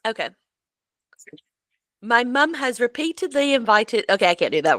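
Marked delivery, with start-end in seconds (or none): 2.0-4.1 slightly higher, light Cockney reading of the quote; a half-hearted Cockney try, reading a letter aloud; Cockney accent. Pretend. Reading his post to her co-host in a light Cockney accent. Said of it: "You went a little Cockney there".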